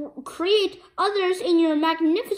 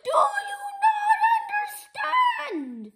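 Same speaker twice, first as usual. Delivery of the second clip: high, slow, sung robotic yell; booming robot roar; 11 semitones higher, slower than usual. Acted. Evil robot shouting at the hero. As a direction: roar it like a mad machine.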